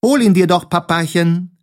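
high, teasing dare; laughing taunt. A narrator's reading. Sams taunts Papa with the key, high teasing voice. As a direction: tease in a high little voice. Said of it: "lachte das Sams".

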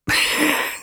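unvoiced high giggle; high laugh. A narrator's reading. Kiosk man laughing at the bunker joke, high giggle. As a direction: burst into a laugh.

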